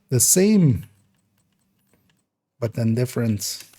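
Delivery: usual speaking voice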